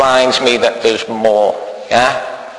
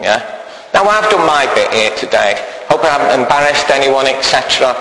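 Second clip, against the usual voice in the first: ordinary talk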